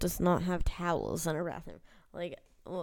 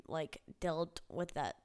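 normal talking voice

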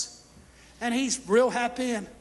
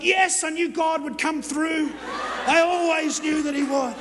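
loud, higher, slow exultant cry; overjoyed shout of vindication; triumphant shout; 4 semitones higher, 5 dB louder, slower than usual. Retold or imitated. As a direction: crow with victory. Triumphant on getting money back.

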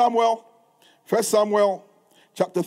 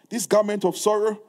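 ordinary delivery

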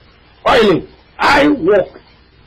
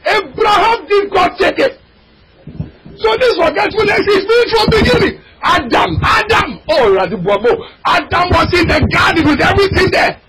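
fiery preaching; 9 semitones higher than usual. Acted. Preacher crying out to the congregation, fiery. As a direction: preach it out, voice cracking high.

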